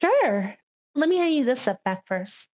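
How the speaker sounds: usual speaking voice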